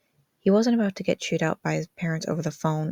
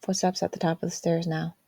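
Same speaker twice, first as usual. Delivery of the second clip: ordinary delivery, nothing marked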